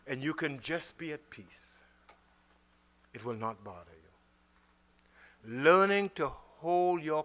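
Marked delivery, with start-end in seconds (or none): 0.1-5.6 much lower, softer and slow; calm assurance. Real, not acted. Preacher calming the listeners, low and slow. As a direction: settle them down gently.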